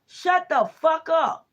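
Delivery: high, slow, menacing growl of the deity; hostile spirit voice. Retold or imitated. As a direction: voice the angry spirit stretching each word. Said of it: "Or when you crying".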